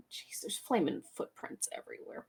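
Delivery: whispered under the breath. Acted. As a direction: hush it like spotting something alarming. NPC inspecting the footprints.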